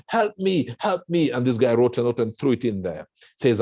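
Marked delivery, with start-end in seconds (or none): 0.1-1.3 mock scream for help. Man in a ditch begging a second passerby, high mock scream. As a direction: call up high from the hole like you're stuck. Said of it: "this guy started screaming".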